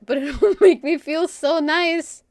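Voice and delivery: giggling out a guilty pleasure; laughing through it. Retold or imitated. To the viewers, admitting the urge with a slow giggle. Gleeful quote about the urge.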